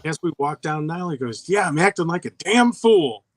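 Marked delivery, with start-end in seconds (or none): 1.5-3.2 mildly yelled, higher-pitched boast; mock yell of a child; imitated kid's yell. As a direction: call it out like a kid, pitched up. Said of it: "he yells it out".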